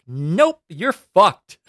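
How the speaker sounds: loud, high taunting denial; loud gleeful verdict. Retold or imitated. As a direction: shout the damnation with glee. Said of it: "And you're like".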